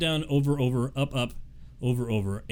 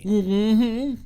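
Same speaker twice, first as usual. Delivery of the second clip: odd mm-hmm; 6 semitones higher, 3 dB louder than usual. Real, not acted. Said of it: "I don't know why I said it that way".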